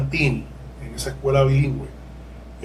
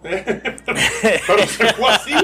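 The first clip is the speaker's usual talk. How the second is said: slow, laughing, pitched up; chuckling at the blunder; 4 semitones higher, much slower than usual. Real, not acted. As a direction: laugh at your own slip. Laughs at own courtroom blunder.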